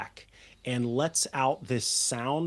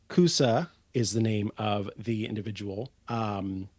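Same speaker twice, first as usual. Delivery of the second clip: ordinary talk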